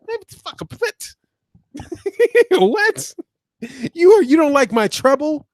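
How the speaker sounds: very high-pitched, laughing incredulity; real amused disbelief. Real, not acted. Streamer reacting to a complaint about his treble, in high incredulous disbelief. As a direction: squeak out baffled disbelief through a laugh.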